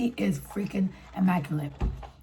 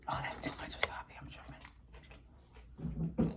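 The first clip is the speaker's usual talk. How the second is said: whispered aside to herself; 22 dB quieter, much faster than usual. Real, not acted. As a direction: breathe it under your voice.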